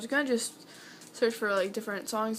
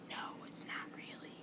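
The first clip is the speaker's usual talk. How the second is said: whispered reply; 15 dB quieter than usual. Retold or imitated. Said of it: "And I'm like".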